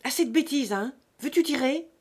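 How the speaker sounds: loud, high, stern order; impatient father's sharp command; harsh interruption. A narrator's reading. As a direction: cut them off hard. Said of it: "Fouan l'interrompit durement".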